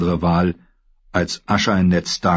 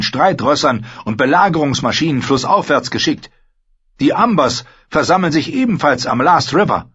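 put-on higher, louder young lord ranting; raised, frustrated voice of an embattled lord; Theon, angry and anxious; 8 semitones higher, 4 dB louder than usual. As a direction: build up the grievance, then demand.

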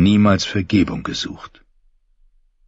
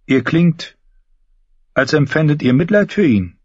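Brienne; 8 semitones higher than usual. Acted. Questioning the brother about his pity for the dying man, as Brienne. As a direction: lift the voice into another character's register. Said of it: "sagte Brienne".

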